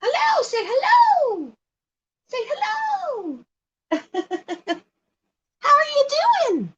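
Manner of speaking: very high sing-song pet voice; talking to the bird. Real, not acted. Owner coaxing her bird to say hello, very high cooing pet talk. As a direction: coo up high to coax the bird into speaking.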